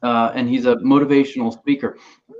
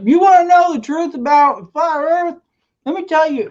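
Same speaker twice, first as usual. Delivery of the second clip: much higher, hammed-up know-it-all voice; high mock conspiracy voice; 13 semitones higher, 3 dB louder than usual. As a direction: squeak it out like a smug conspiracy guy. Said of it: "He'll be like".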